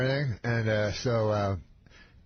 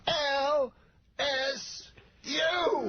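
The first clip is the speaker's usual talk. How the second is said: very high shout, each letter stretched alone; exaggerated slow-motion comedian rousing a crowd; Bill Cosby; much slower than usual. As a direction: belt L, S, U with big gaps. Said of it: "every time slower".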